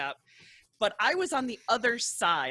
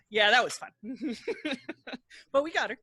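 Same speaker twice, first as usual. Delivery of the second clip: ordinary talk, nothing marked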